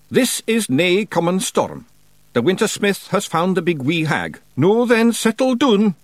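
high Scots voice, rising to loud; Feegle chieftain rallying. A narrator's reading. Rob warns his clan of the Wintersmith, high Scots voice. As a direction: announce it to the clan, building to a high, loud call.

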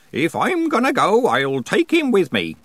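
put-on high old countryman, slowing to finish; cheerful old man. A narrator's reading. Old man boasts to Nanny about death, cheerfully. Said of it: "said the old man cheerfully".

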